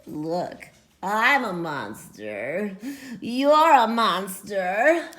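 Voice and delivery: slow low monster talk; slow cheerful monster voice. Acted. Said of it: "or the monster says".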